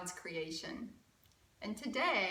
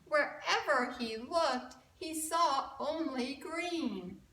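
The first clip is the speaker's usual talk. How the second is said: lively rhyming storybook read; reading to children; 8 semitones higher, 7 dB louder than usual. A narrator's reading. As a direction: read it like a picture book for kids. Rhyming picture book read aloud.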